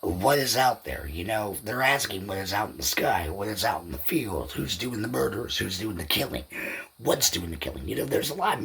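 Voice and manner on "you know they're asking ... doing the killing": normal talking voice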